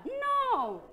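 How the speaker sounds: sharp high loud no; a loud, high-pitched no; emphatic no. Real, not acted. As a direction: cut the complaint off firmly. Teacher rejects pupil's complaint.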